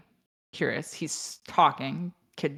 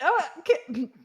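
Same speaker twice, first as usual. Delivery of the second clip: mock surprise; 10 semitones higher than usual. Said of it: "I was like".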